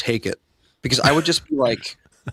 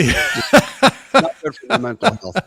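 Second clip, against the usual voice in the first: laughing; 9 semitones higher, much slower than usual. Real, not acted. Laughing slow remark.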